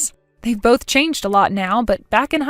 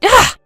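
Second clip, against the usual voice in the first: loud high exasperated ugh; angry frustrated ugh; frustrated groan; 11 semitones higher, 6 dB louder than usual. Acted. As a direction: let out a furious groan. Frustrated outburst.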